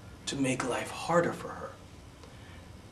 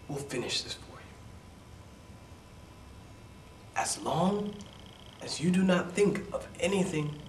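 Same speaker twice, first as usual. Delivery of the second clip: put-on soothing old woman; 5 semitones higher, 4 dB quieter than usual.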